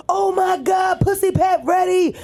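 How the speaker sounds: high shrieking fan squeal; screaming fan. Retold or imitated. Girl runs up to him in line, shrieking. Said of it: "one girl running up like".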